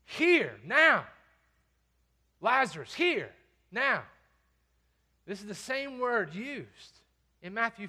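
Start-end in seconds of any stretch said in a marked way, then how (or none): 0.0-1.0 loud, high barked order; sharp command. Acted. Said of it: "they come across more like this". 2.4-4.0 loud, raised summons in short bursts; powerful command. Acted. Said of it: "they come across more like this".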